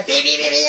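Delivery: loud high honking beeps; car horn beeps. Retold or imitated.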